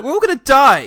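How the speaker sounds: hammed-up panic; mock Anakin. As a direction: wail it like a sulky teen. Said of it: "It's like".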